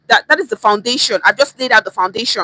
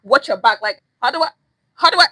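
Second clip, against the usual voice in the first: ordinary talk, nothing marked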